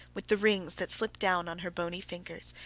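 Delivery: ordinary delivery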